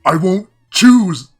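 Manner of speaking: high, loud, slow strained cry; choked-out defiance. A narrator's reading. Player defying the strangler, choked-out cry. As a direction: force each word out against a closing throat. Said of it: "you choke out".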